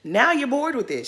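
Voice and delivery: ordinary delivery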